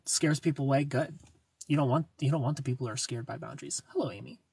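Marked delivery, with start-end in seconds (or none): none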